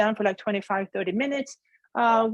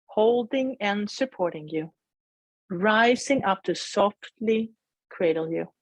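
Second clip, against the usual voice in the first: guided meditation. Acted. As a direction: gently guide them inward.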